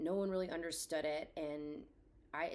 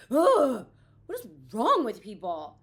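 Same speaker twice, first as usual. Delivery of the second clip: loud, fed-up groan into the question; real frustration, let it burst out; exasperated outburst; 3 semitones higher, 14 dB louder, slower than usual. Real, not acted. Grieving mom vents at insensitive people, loud and fed up.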